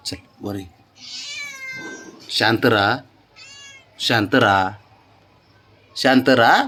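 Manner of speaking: low, soft and steady; soft settling. Real, not acted. Owner settling the growling mother dog, soft and low. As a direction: settle her down quietly, one calm word at a time.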